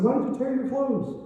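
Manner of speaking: prophet's question. Acted.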